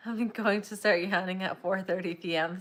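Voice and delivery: usual speaking voice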